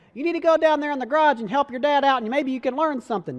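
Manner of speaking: lightly put-on nagging mother; imitating mom. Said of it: "That's not how she sounds".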